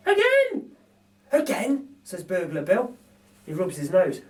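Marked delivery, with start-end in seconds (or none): 0.1-0.8 put-on very high, louder toddler squeal; gleeful baby shout. Narrator voices laughing baby demanding more, squealing. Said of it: "The baby starts to laugh and shouts". 1.3-2.0 higher, rising mock dismay; lightly voiced reluctant thief; dismayed burglar. Narrator voices Bill after falling off stool, dismayed. Bill's voice in read story.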